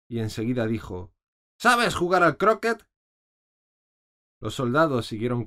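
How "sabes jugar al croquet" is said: much higher, loud demand; shouted question. A narrator's reading. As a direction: bark the question. (pregunta a gritos, aguda)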